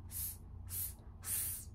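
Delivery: long hissing "s, s, s" with no voice; s sound. Shown as a demonstration. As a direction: hiss "s" like air leaking. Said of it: "Can you say".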